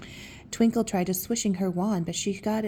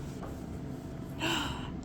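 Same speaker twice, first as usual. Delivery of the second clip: sharp gasp; 7 semitones higher, 11 dB quieter than usual. Real, not acted. Reacting to the magic tree.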